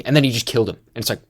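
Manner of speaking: ordinary talk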